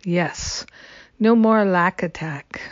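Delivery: ordinary talk, nothing marked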